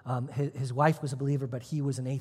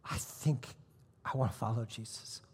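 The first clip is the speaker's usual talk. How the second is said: hushed confession; 4 semitones lower, 7 dB quieter than usual. Retold or imitated. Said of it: "And he says".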